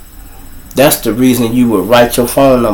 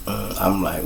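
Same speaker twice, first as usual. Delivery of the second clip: ordinary talk, nothing marked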